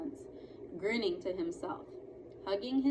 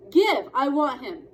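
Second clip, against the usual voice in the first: eager demand; 5 semitones higher, 11 dB louder than usual. Acted.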